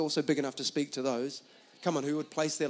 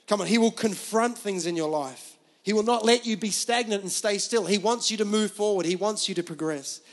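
loud, driving sermon delivery; full-force preaching; 6 semitones higher, 6 dB louder than usual. Acted. Preacher urging the congregation to hear God, full-force preaching. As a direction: preach it with urgent conviction.

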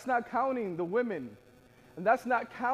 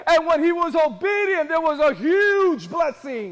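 loud, high preacher's climax; forceful preaching; 6 semitones higher, 9 dB louder than usual. Real, not acted. Preacher telling the congregation about obedience rewarded, forceful preaching.

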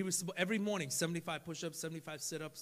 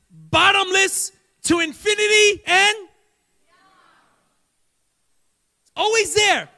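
preaching; 9 semitones higher, 16 dB louder than usual. Acted. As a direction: build it up from the pulpit. Preaching at full volume.

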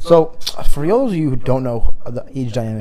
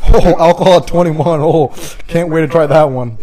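sarcastic; 4 semitones higher, 6 dB louder than usual. Acted. Sarcastic mock excitement.

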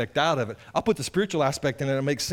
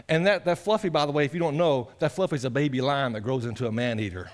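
ordinary delivery

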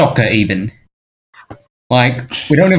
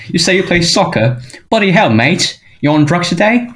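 pitched-up mock Aussie bloke; jokey Aussie accent, pitched up; mock Australian; 6 semitones higher than usual. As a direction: ham up a cheeky Aussie mate. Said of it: "That's, that's Australian".

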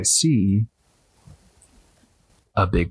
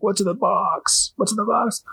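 high, frantic pleading about the box; strained, panicked movie-detective cry, pitched up; Brad Pitt in Seven; 12 semitones higher than usual. Retold or imitated. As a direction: imitate a desperate detective begging to know. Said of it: "invoked Brad Pitt's character in the movie Seven".

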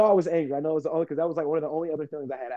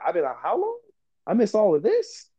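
incredulous; 6 semitones higher than usual. Pretend. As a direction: pretend disbelief, rising high at the end. Imagined waking after long unconsciousness.

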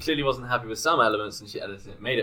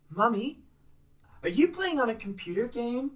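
put-on little girl voice; 12 semitones higher than usual. The daughter in the mum's post asking her mother, in a high little girl voice. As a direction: ask like a curious little girl.

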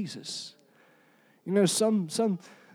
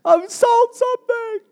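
very high, squealed celebration; over-the-top giddy excitement, way up high; excited crowing; 10 semitones higher, slower than usual. Acted. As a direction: crow it in a high, stretched-out voice. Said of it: "I was excited".

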